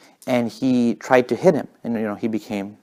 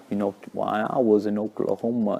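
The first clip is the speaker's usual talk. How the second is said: softer, unhurried slow-talker imitation; 6 dB quieter than usual. Country singer chatting in a pickup, slow talk. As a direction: imitate a leisurely way of talking. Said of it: "he has a really slow way of talking".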